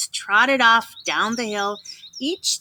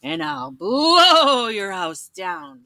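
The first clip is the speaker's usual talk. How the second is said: wolf gloating at the pig; laughing wolf; much slower than usual. Acted. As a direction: draw out the threat slowly. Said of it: "laughed the wolf".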